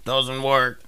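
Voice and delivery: ordinary talk, nothing marked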